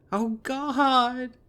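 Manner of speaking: high, loud crying wail; sobbing wail. Acted. Heather bursting into tears before her friends, loud shrill wail. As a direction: break into a wailing sob. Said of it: "Heather begins to sob".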